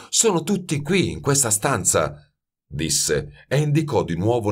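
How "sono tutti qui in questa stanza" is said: understated, eager insistence; insistent apprentice. A narrator's reading. Youth points out the aromas to master, insistent. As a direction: press the point without raising the voice.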